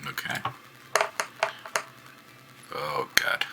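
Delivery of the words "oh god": real unvoiced groan of dismay; dismayed whisper. Real, not acted. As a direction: whisper it in despair at the roll. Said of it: "bad roll reaction".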